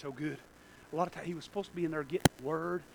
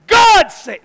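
high, loud pulpit shout; preaching at full force; 13 semitones higher, 18 dB louder than usual. Real, not acted. Preacher declaring God's word, full-force preaching. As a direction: thunder it out to the room.

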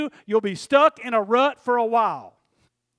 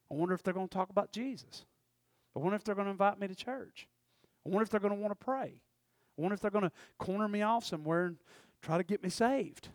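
soft, fast nervous inner voice of relatives; mock wary muttering; 3 semitones lower, 9 dB quieter, faster than usual. Relatives dreading a preacher at the reunion, soft and fast. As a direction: mutter it like a worried cousin dreading church talk.

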